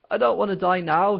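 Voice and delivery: high, loud, panicky yell. Acted. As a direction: scream it in terror. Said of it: "he yelled".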